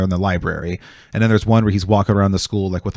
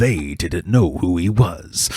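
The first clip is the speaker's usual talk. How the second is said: movie trailer voice; much slower than usual. Retold or imitated. Retelling a comedian's trailer bit, slow dramatic narrator. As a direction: draw out each phrase like an epic trailer. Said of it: "this one whole trailer he does".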